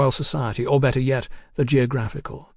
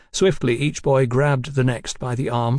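ordinary delivery, nothing marked

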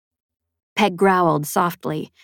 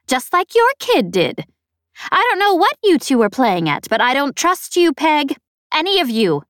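high, loud, boastful snappish alien; 8 semitones higher, 4 dB louder than usual. A narrator's reading. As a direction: brag about your champion, snapping. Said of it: "snappish and vaguely familiar".

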